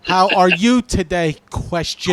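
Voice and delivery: slow dictation with punctuation spoken; careful voice-text speech. Shown as a demonstration. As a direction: dictate it deliberately to the phone. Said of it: "talk like this when I do a voice text".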